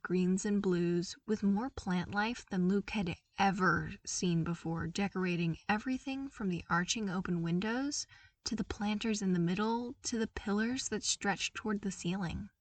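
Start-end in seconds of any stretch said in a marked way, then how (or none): none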